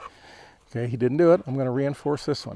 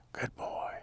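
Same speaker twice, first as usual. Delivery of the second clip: breathy whisper of praise to the dog; 15 dB quieter than usual. Real, not acted. Trainer whispers praise to the dog, whispered. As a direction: hush it, just for the dog.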